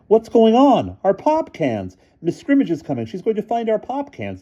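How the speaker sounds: high loud alarmed voice; panicked. A narrator's reading. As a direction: panic over the pop cans. Boys panicking as cans spill.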